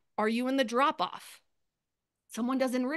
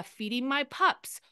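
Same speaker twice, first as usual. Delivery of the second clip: slow example post; 5 semitones higher, 5 dB louder, slower than usual. Shown as a demonstration. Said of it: "Or, uh".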